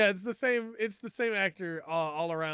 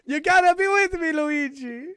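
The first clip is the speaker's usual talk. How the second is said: hammed-up Italian Mario accent, much louder and higher; 10 semitones higher, 10 dB louder than usual. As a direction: yell to Luigi in the trenches, mock Italian accent. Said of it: "I can't do the accent".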